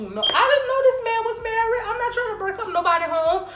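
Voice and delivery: emphatic denial. Real, not acted. Insisting to viewers she never knew he was married, emphatic. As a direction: protest it hard to the viewers. Denying being a home-wrecker.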